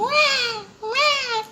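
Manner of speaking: put-on very high wailing baby cry "ué, ué"; newborn cry imitation. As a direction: mimic a newborn's thin wail. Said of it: "Que ele chorinho".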